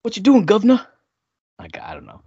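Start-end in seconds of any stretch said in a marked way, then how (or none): 0.0-0.9 put-on high, loud cheeky Cockney English accent; hammed-up English accent greeting. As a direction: play a London street geezer in English accent. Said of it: "Your accent is very good".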